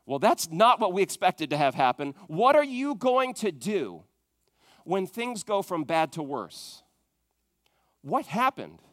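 ordinary talk, nothing marked

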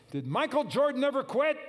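high, loud coach's challenge; coach shouting. Retold or imitated. Coach challenging his players in the locker room, shouting. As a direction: fire the question at the team like a coach. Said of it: "the coach stands before the team and he says".

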